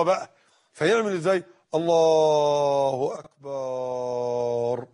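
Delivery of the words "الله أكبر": low, very drawn-out imam's takbeer; seasoned old imam. Acted. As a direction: intone it low, dragging both words out endlessly. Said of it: "فيسوي حاله إن هو راجل إمام قديم وعنده خبرة". (تكبير إمام قديم، ممطوط جدًا وبصوت منخفض)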